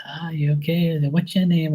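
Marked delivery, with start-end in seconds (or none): 0.1-1.0 high mock old-aunt voice; great-aunt quote. Great-aunt checks on the boy, high mock voice. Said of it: "she looked at me and she goes". 1.1-1.7 high mock old-aunt voice; great-aunt quote. Great-aunt checks on the boy, high mock voice. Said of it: "she looked at me and she goes".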